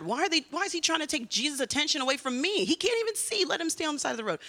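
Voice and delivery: high, indignant complaint; imitated mad bystander. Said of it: "Maybe mad".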